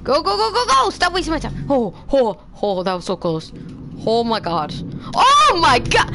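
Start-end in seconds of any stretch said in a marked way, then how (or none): 5.1-6.1 high, loud cry of alarm; panicked shout. Real, not acted. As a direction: yell in panic. Panic in game.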